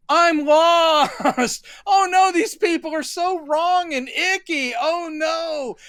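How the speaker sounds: high, squealing mock heroine, slow on the middle; hammed-up high damsel wail; mock damsel in distress. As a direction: ham up a lost heroine in a panic. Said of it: "her going like".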